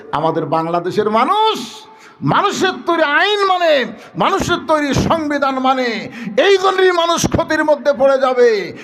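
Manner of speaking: high-pitched sermon oratory; impassioned preaching. Real, not acted. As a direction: drive each clause home to the crowd.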